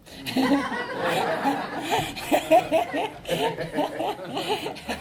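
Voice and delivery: helpless high-pitched giggling; long breathy run of laughs; real laughter. Real, not acted. Speaker amused at her own Bible study story, laughing hard. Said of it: "I'm laughing because".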